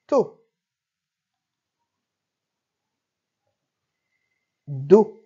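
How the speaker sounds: syllables read one at a time, slow and clear; lecture de syllabes. Shown as a demonstration. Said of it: "on a fini les syllabes". (syllabes détachées, articulées)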